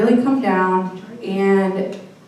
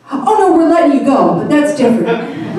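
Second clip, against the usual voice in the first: breezy HR guy; 4 semitones higher, 5 dB louder than usual. Retold or imitated. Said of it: "He goes".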